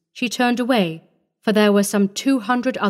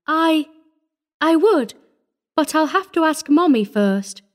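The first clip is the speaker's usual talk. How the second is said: lightly voiced shy little girl, higher-pitched; 8 semitones higher than usual. A narrator's reading. Orphan girl saying she must ask Mommy, shy. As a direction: add it shyly and haltingly in a child's high voice. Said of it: "she added shyly".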